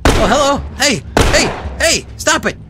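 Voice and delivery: loud, high, rapid-fire exclamations; frantic gamer outbursts; startled combat yelling. Real, not acted. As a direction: shout in flustered frustration. Surprised by attackers in fight.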